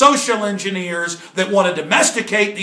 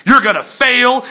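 usual speaking voice

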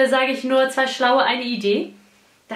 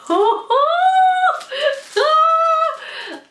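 delighted shriek; 3 dB louder than usual. Real, not acted. Finds favourite sweets.

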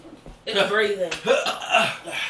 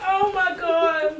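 laughing; 8 semitones higher, much slower than usual. Real, not acted. Laughing fit.

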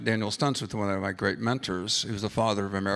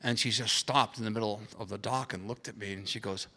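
ordinary talk

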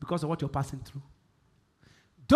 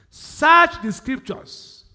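slow, louder and higher Search the scriptures; slow emphatic command; 6 semitones higher, 8 dB louder, much slower than usual. Real, not acted. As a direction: drag out each word like a command. Preacher emphasizing instruction.

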